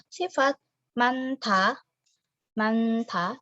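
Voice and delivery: manta with the n carried into ta; Korean word demo. Shown as a demonstration. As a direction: say manta as the learners should copy it. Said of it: "dibaca seperti nian dengan ta". (ucapkan manta seperti contoh guru)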